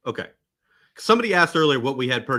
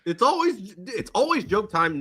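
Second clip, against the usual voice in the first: squeaky-high, drawn-out joking protest; high mock insistence; 9 semitones higher than usual. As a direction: push the pitch way up, teasingly.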